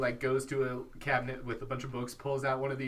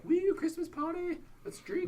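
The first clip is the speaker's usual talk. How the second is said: put-on high, slow ditzy party girl. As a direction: squeal like a tipsy sorority girl. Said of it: "all the girls being like".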